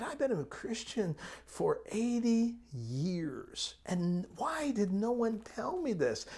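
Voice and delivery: a hint of an upset old woman asking why; old lady. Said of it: "she was eighty-eight years old".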